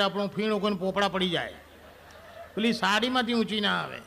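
normal talking voice

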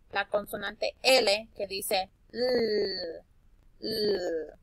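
slow, drawn-out "L"; L sound demo. Shown as a demonstration. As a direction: hold the "L" sound slowly. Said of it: "comienza con la L". (sonido de L alargado)

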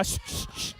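sharp repeated voiceless shush. Real, not acted. Host quiets the children before the game, shushing. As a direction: hush a noisy room.

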